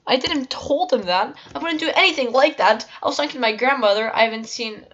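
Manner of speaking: high, squeaky mock self-defence; nervous squeaky voice. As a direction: plead innocence in a high voice. Imitating own defensive apology.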